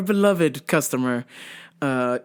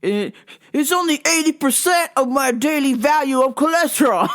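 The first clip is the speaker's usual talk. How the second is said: mock indignant yell; 7 semitones higher than usual. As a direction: yell it like the stubborn caller. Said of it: "And he goes".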